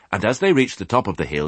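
plain reading voice

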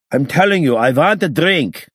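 put-on loud, high accented insistence. A narrator's reading. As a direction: insist hotly, voice climbing well above normal. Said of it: "raising his voice".

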